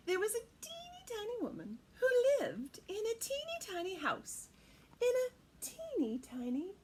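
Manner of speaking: lilting read-aloud; storybook narration. A narrator's reading. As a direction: read it like a picture book.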